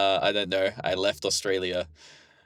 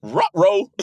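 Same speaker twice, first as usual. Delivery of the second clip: high, loud cartoon dog voice; Scooby-Doo; 9 semitones higher, 6 dB louder than usual. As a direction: yelp it like a talking cartoon dog. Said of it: "Do you have a Scooby-Doo voice?".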